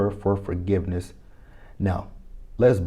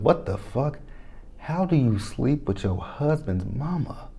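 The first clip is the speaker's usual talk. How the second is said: shocked; 4 semitones higher, 6 dB quieter, slower than usual. Retold or imitated. Her shocked reply in the retelling.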